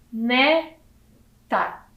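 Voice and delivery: "Neta" split, ne... ta; syllable by syllable. Shown as a demonstration. Teacher revealing the word to children, syllable by syllable. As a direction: sound out "Neta" slowly with a clear gap. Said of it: "Que palavra é essa?".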